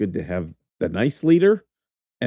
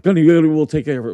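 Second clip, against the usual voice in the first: panicked scramble; 6 semitones higher, 6 dB louder, faster than usual. Retold or imitated.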